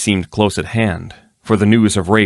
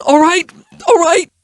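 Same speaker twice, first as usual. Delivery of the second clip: high, loud frightened cry; terrified yelp; 6 dB louder than usual. Acted. Ruffian cries out to Erilyn in fear, high and loud. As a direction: yelp the denial in panic.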